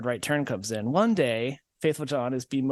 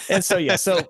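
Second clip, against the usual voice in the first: high, louder words through laughter; laughing through words; 6 semitones higher, 5 dB louder than usual. Real, not acted. Storyteller laughing while trying to resume the tale. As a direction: push on while still laughing.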